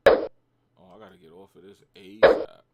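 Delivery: panicked loud exclamation; alarmed outburst. Real, not acted. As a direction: yell it as the trade tanks. Trade suddenly going bad.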